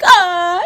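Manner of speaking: hammed-up high wail; a mock-dramatic wailing cry. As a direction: let out a theatrical wail, pitched high. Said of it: "Hermione starts wailing like".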